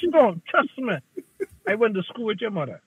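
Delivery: ordinary delivery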